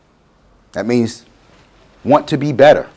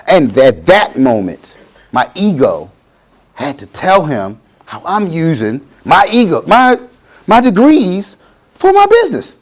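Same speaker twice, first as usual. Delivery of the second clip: usual speaking voice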